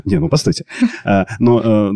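ordinary talk, nothing marked

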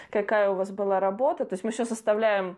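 ordinary talk, nothing marked

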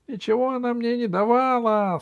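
high, squeaky gnome voice; little gnome protesting. Acted. Accused gnome denies getting anything from Алиса, high squeaky protest.

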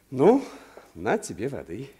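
ordinary talk, nothing marked

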